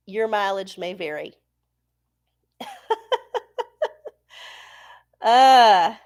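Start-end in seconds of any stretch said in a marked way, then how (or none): 2.6-4.1 high laugh. Real, not acted. Laugh after joke.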